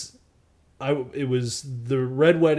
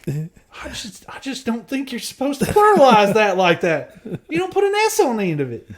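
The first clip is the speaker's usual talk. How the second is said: high mock voice; 9 semitones higher, 5 dB louder than usual.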